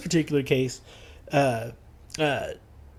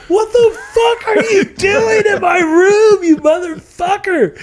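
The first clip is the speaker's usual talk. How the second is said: very high, slow shout through laughter; hammed-up woken roommate yelling; mock furious shout; 6 dB louder, slower than usual. Roommate woken by the kicked door, very high shout.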